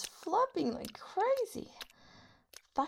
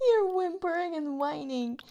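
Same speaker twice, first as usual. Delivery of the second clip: higher, louder, through laughter; laughing taunt; 7 semitones higher, 7 dB louder than usual. Acted. Mocking the partner's whimpers through laughter. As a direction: taunt them while still laughing.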